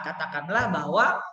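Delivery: ordinary delivery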